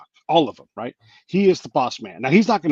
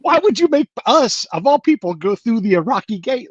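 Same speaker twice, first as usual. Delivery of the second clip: mock whining; 10 semitones higher, 6 dB louder than usual. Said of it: "And we're like".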